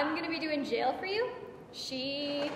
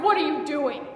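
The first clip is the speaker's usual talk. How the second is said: loud, slightly raised cry; startled cry; 3 semitones higher, 8 dB louder than usual. Acted. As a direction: cry out as if jolted awake. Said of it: "and he cried".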